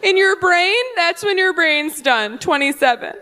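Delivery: high, insistent know-it-all woman; mock heckler explaining herself; imitated audience heckler. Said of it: "yell at me from the audience".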